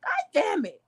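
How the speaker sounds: exasperated. Real, not acted. Reacting to a baby killed, exasperated. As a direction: throw up your hands.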